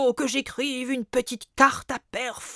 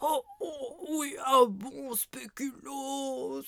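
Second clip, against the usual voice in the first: sleep talking; 8 dB quieter than usual. Acted. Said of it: "il parle en dormant".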